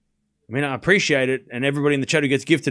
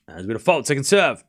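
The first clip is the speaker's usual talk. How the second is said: brisk running commentary, rising at each name; live play-by-play; 3 semitones higher than usual. Acted. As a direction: call the rally as it happens.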